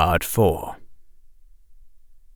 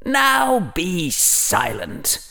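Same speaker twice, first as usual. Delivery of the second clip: high, slow, drawn-out command; Dark Lord voice; 9 semitones higher, 3 dB quieter, much slower than usual. Acted. Harry inwardly silencing Bellatrix as the Dark Lord, commanding. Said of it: "simulating the Dark Lord".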